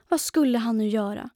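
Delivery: ordinary delivery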